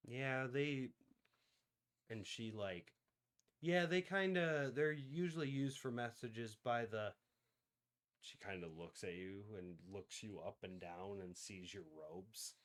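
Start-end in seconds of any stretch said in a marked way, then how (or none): none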